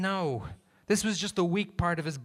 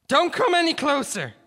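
panicked high yell; shouting; 13 semitones higher, 8 dB louder than usual. Acted. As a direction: scream a warning. Gunman warning.

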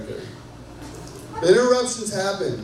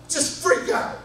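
mock freak out; 10 semitones higher than usual. Mock panic at God's timing.

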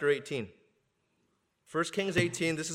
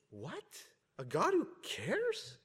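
mock astonishment; 6 semitones higher, 6 dB quieter, much slower than usual. Astonished first hearers of Peter.

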